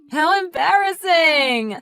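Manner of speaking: slow, sneering exclamation of fake sympathy; mocking shout. Teasing a friend left waiting alone, mocking shout.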